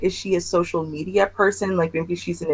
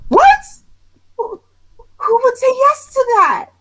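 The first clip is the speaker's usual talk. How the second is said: very high-pitched, louder disbelief; incredulous outrage; 14 semitones higher, 4 dB louder than usual. Real, not acted. Outraged at the husband scenario.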